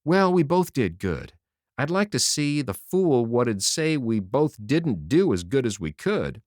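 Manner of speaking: usual speaking voice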